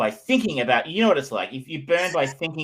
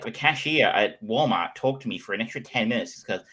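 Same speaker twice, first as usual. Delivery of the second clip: ordinary delivery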